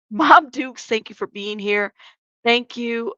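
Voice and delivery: normal talking voice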